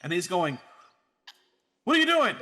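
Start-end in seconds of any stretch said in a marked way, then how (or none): 1.8-2.3 high, loud mock outcry; raised-pitch imitation of alarm; retold alarmed yell. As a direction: yelp it as the friend being hurt. Retells friend with foot pinned.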